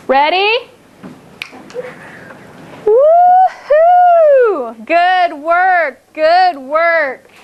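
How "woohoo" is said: high, drawn-out celebratory "woohoo"; excited whoop. Real, not acted. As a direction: whoop high for the class's success. Teacher cheers the children's right answer.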